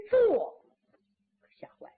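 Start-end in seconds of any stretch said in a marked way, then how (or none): none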